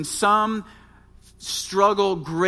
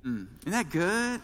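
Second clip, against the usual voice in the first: ordinary talk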